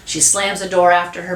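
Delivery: usual speaking voice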